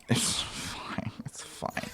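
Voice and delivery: hushed, voiceless it's fine; whispered to himself. Real, not acted. Player whispering to himself after the game broke. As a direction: whisper it to calm yourself down. Muttered self-reassurance after glitch.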